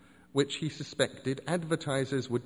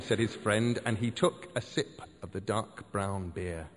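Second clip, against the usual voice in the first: plain reading voice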